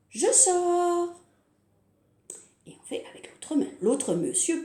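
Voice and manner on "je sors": higher, louder cheerful cry; cheerful pop-out. Pretend. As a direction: burst out happily. Thumb pops out.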